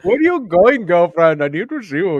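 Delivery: exaggerated singsong street harasser; catcaller. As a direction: call after them with mock flirtation. Acts catcallers following him home.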